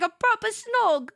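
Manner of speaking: a hint of a British accent; mock British accent. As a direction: say it like a Love Island lad. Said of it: "He's like".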